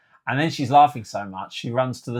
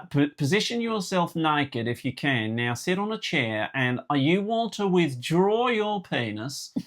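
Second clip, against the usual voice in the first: imitated Australian-South African accent; 6 semitones higher than usual. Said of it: "Oh, oh, what is South African".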